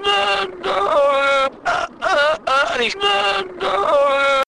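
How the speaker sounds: high strained gasping uhs; clutching-chest fake gasps, pitched high; mock soap heart attack. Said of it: "heart attacks they have on soap operas".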